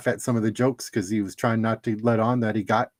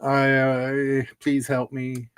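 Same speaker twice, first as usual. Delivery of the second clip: slow bored character voice; much slower than usual. Retold or imitated. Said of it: "She's like".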